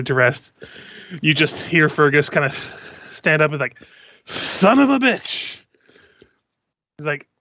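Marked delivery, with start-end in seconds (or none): 4.3-5.6 higher, drawn-out exasperated curse; frustrated outburst. Acted. Fergus stands up in camp before his companions, frustrated outburst. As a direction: let the frustration burst out in character. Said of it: "getting a little frustrated".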